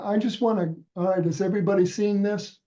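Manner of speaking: normal talking voice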